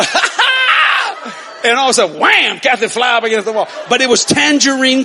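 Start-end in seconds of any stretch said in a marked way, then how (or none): 0.0-1.6 high, loud whooping laugh; gleeful laugh. Retold or imitated. Uncle swerving the van full of kids, whooping laugh. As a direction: cackle with glee at the wheel.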